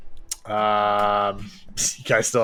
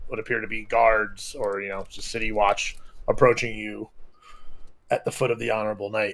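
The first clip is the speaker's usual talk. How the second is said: ordinary talk, nothing marked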